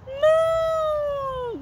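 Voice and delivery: very high, drawn-out moo; high held animal noise; cow moo. Pretend. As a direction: moo like a little toy cow. Imitating a cow for child.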